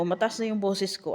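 normal talking voice

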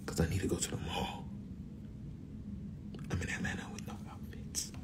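hushed whisper to the camera. Real, not acted. Whispering her plans to the viewers, hushed. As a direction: breathe it as a secret.

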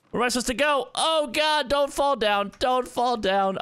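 high-pitched, rising panic; panicked. Real, not acted. Player afraid of falling off the trees, panicked. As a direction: plead with yourself in a high, panicky voice. About to fall in the game.

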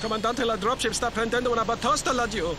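urgent radio call. Acted. Dropship under attack, urgent report.

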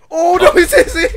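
loud, high laughing cry; laughing panic. Real, not acted. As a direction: blurt it out through laughter. Laughing panic as game goes wrong.

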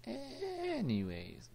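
very high, long drawn-out first vowel. Real, not acted. Gamer changing the subject, silly high squeal. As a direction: squeak it out slowly. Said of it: "my voice can't go that high".

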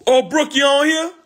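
high, loud call across the room; high excited call to a friend. Real, not acted. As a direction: sing out her name to get her attention. Calling out to viewer Brooke.

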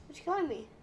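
usual speaking voice

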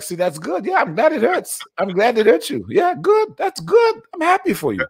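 very high, shrill-pitched mocking mom voice; hammed-up told-you-so mother crowing; gloating Haitian mom. Host imitates his mom mocking her crying hurt child. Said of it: "and they're like".

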